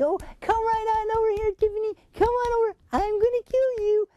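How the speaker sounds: high, sugary call to a beloved dog; sweet coaxing tone. Shown as a demonstration. Owner calling her golden retriever over, high sweet coaxing. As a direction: coax her over sweetly, no matter the words. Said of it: "she's listening to my tone".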